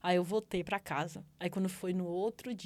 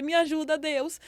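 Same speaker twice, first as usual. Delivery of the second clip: high, raised plea to God; crying out; 8 semitones higher, 5 dB louder than usual. Retold or imitated. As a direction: re-enact a desperate call for help. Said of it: "eu comecei a gritar dentro da igreja". (clamor agudo por socorro)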